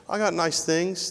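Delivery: ordinary delivery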